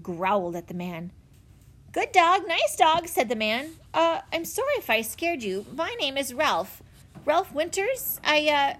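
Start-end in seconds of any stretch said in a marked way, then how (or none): 1.9-3.1 high, coaxing a wary dog; nervously placating a growling dog. Acted. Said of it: "looked at Watch nervously". 3.9-8.7 higher, hesitant, stumbling apology; a flustered visitor introducing himself; a hint of a nervous man; lightly voiced nervous stranger.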